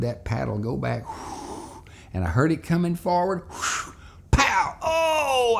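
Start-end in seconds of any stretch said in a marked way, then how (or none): 3.5-4.8 paddle whoosh and smack. Retold or imitated. Paddle swing sound effect. 4.8-5.6 mock yelp of pain. Recalls the paddle's sting.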